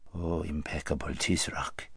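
a fawning whisper. Acted. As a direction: breathe the flattery in a whisper. Vizier whispers flattery.

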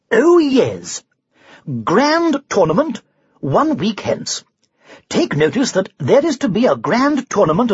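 high, ringing announcement; town crier proclamation. Acted. Knight reads a tournament notice to his companions, in a crier's proclamation. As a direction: proclaim the notice grandly, drawing out key phrases.

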